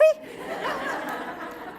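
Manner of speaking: airy high laugh. Real, not acted. After the selfie anecdote.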